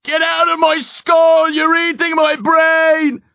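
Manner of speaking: high, slow, agonised screaming; tormented scream. A narrator's reading. Man in a nightmare yelling at tormentors, screamed. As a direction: scream as if fighting off attackers.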